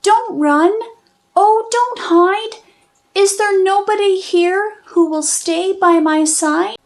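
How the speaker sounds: high, pleading little mouse; tiny mouse voice. Acted. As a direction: call out small and high, pleading. Mouseling calling out, lonely.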